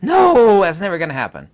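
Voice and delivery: a high, loud scoffing mock of the doubters. Mocking viewers who said it would never happen, scornful. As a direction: parody their dismissive denial. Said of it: "you all said".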